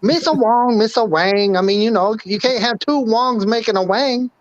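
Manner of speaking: Mister Wang voice. Pretend. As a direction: raise the pitch into a broad Chinese accent. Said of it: "My name is Mister Wang".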